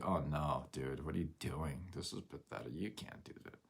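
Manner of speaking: low, soft naysayer, fading away; mock a discouraging downer, voice dropping; lightly mocked naysayer, trailing into near-silence; negative Debbie Downer. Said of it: "negative Nancy, a Debbie Downer that tells you".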